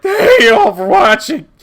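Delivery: loud, high strained shout; mock strained yell after hours of voicing; worn-out character voice. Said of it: "by the end of it, I was like".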